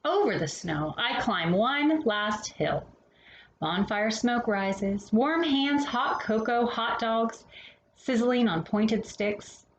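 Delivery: gentle bedtime picture-book read-aloud; storybook reading. Acted. Reading a winter picture book to children, gentle storytelling.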